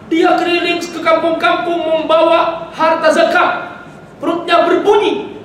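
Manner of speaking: high, ringing preacher's voice at full force; fervent preaching. Acted. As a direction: preach it out to the whole hall.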